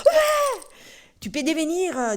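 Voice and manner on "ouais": very high, loud laughing yes; puppet cheer. Acted. Puppet Tolo agrees to help, high cheer. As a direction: cheer as the eager teddy bear. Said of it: "Ça, c'est Tolo".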